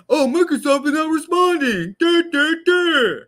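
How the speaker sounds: very high and loud mock computer voice; loud squeaky mock of a crashing PC; mocking the error message. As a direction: parody a frozen program announcing itself.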